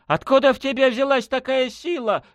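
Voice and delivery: high, cracking panicked cry; voice breaking. A narrator's reading. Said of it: "воскликнула Настя срывающимся голосом".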